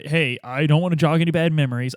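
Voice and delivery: ordinary delivery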